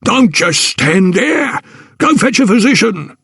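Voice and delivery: high, slow barked order; barking porter. Acted. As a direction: bark the order at her. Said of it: "he barked".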